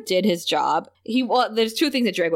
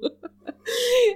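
high laugh. Real, not acted. Laughing at Jinx's line.

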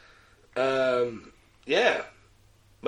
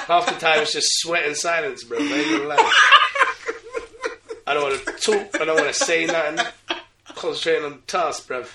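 louder, higher, through laughter; laughing loudly; 5 semitones higher, 7 dB louder than usual. Real, not acted. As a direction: confess it while laughing. Laughing loud confession.

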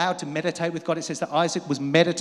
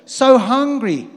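so hungry, drawn out high and loud; emphatic hunger; 8 semitones higher, 11 dB louder, much slower than usual. Acted. Preacher crying out his spiritual hunger. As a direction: stretch out the hunger with full force.

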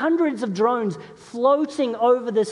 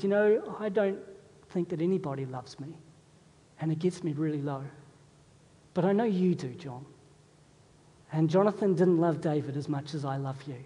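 hushed, confiding letter voice; softly reading a lonely friend's reply; soft, heartfelt letter; 6 semitones lower, 6 dB quieter than usual. Retold or imitated. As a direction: read it softly and low, touched. Pastor reads a lonely friend's letter.

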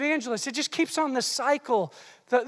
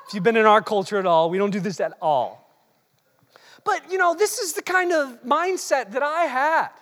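ordinary delivery

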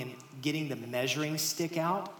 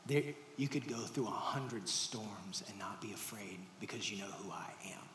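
hushed, intimate whisper, speeding up at the end; whispered reassurance; 7 semitones lower, 10 dB quieter than usual. Acted. Jesus whispers to frightened disciples, reassuring. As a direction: breathe the promise close, barely voiced.

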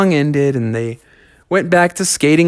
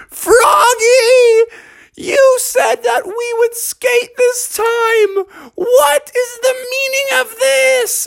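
upset yell; slower than usual. A narrator's reading. Beatrice catching Froggy with the fox, crying shout. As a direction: wail at him, very high and hurt. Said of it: "she yelled out".

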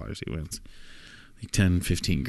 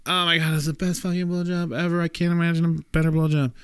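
much higher, gushing in delight; ecstatic raving; 9 semitones higher than usual. Acted. John to the prostitute, raving high with delight. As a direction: gush up high like he's seen heaven.

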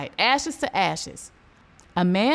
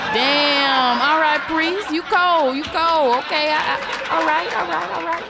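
stunned reaction; 5 semitones higher, 4 dB louder than usual. Real, not acted. Host reacts to the cold punchline.

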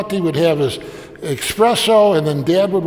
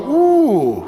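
high, drawn-out ooh of wonder; a kid pretending to be dazzled; mock impressed ooh; 7 semitones higher than usual. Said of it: "And we'd all go".

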